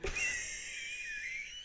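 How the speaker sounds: high, drawn-out giggle; soft squealing laugh. Real, not acted. Guest's story sets host off, high helpless laughter.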